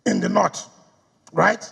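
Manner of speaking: usual speaking voice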